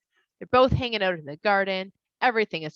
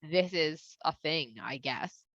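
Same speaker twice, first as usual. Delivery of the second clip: indifferent shrug; 4 semitones lower, 7 dB quieter, much slower than usual. Retold or imitated.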